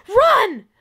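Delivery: sudden loud, high yell; retold urgent shout. Telling how she fled that night, loud urgent shout. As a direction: blast it out as an order to flee. Acting out her urge to flee.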